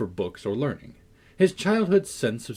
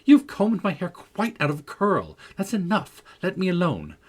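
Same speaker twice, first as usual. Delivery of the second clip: high, loud petulant scolding; 8 semitones higher, 5 dB louder than usual. A narrator's reading. Catherine scolds the maid combing her hair, high and petulant. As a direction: shake the maid off crossly, pitched high. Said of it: "she added petulantly, jerking her head away from my hands".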